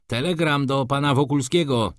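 calling out. A narrator's reading. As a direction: call out the delivery for all to hear. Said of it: "zawołał".

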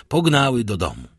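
ordinary delivery

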